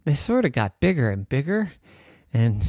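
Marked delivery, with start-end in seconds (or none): none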